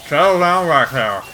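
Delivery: loud, odd put-on voice of a sleazy guy; Steve Buscemi. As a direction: bark it out as a creepy captor. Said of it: "fucking Steve Buscemi's like".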